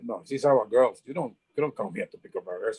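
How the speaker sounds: hint of a street tough warning him off; mild menacing local. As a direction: hoodlum warning the stranger off, low and menacing. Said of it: "He said".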